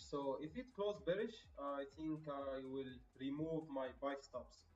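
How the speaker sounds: ordinary delivery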